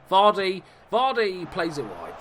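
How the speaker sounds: high, loud call of the player's name; excited commentary. Real, not acted. Gamer commentating on Vardy's attack, excited and loud. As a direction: build excitement, then draw the words out.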